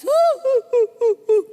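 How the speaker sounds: loud, high-pitched imitated sobs; hammed-up "hu hu" boohooing; mock sobbing. Preacher imitating the woman crying harder, mock sobbing. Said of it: "Makin nangis".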